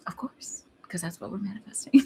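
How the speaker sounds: whispered. Retold or imitated. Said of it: "I was like".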